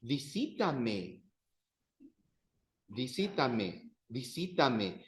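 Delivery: "Visítame" slow, stress on the TÍ; slow model pronunciation. Shown as a demonstration. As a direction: drill "Visítame" slowly, leaning on TÍ. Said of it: "you say exactly like that".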